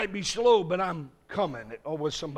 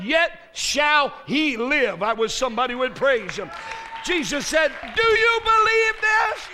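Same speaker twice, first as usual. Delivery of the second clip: full-force preaching; 11 semitones higher, 11 dB louder than usual. Acted. Preacher voicing Jesus at the tomb, shouting. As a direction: thunder it from the pulpit.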